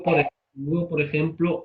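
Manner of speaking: ordinary talk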